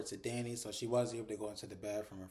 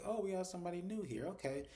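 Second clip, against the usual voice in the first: lightly acted greeting, higher pitch; mock greeting; 7 semitones higher than usual. Man greets somebody new in the background, mock greeting. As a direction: put on a high-pitched welcome for a newcomer.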